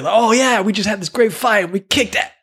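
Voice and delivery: high-pitched mock bragging; excited boasting. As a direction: act out an eager kid recounting a win. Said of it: "they can't say".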